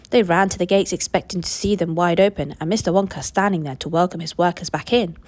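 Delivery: usual speaking voice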